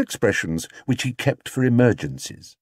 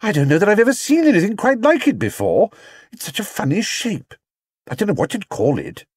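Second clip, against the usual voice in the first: higher mother's voice, bemused; Mrs Brown, puzzled; 10 semitones higher than usual. Acted. Mrs Brown to Paddington about the hat, bemused. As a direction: puzzle over the funny shape.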